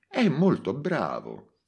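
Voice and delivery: a hint of a wry colleague, drawn out; Mimì's remark. Mimì tells the commissioner, lightly voiced. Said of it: "disse Mimì al commissario".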